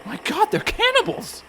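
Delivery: horrified realization, voice shooting high; alarmed cry. Acted. To the crew, horrified they're cannibals, alarmed high cry. Thinks they'll eat him.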